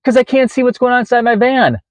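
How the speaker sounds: anxious, strained and high; high agitated voice. Retold or imitated. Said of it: "and my voice is like this".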